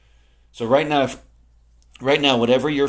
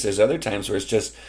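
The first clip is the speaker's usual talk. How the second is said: ordinary talk, nothing marked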